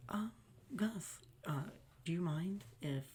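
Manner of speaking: soft, halting, tentative request; timid waitress. Retold or imitated. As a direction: imitate a scared employee approaching the boss. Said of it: "everyone was so terrified of doing anything".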